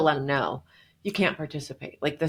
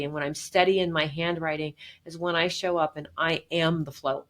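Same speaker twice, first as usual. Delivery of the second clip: ordinary delivery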